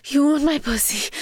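ordinary talk

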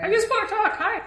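very high, soft creature voice; tiny voice offering reassurance; mysterious little voice. Acted. Unseen creature reassuring the party, high little voice. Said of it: "you definitely hear a voice".

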